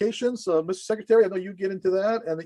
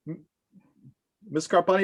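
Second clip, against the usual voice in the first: normal talking voice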